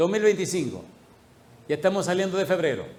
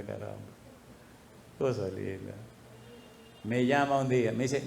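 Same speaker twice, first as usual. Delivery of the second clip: ordinary delivery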